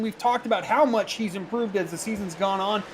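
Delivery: ordinary talk, nothing marked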